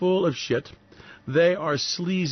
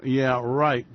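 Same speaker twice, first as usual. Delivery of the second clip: ordinary delivery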